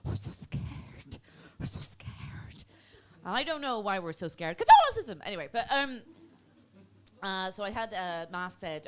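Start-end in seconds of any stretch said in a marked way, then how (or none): 0.0-2.7 slow, drawn-out whisper of mock dread; scared whisper. Comedian voicing fearful Irish people, slow frightened whisper. 4.6-5.2 very high, loud outburst; sudden shout. Acted. Comedian answering why they are scared, sudden high shout.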